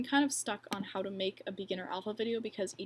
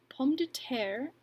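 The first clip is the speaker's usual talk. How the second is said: clumsy French accent; 4 semitones higher, much slower than usual. Shown as a demonstration. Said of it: "Excuse my terrible accent".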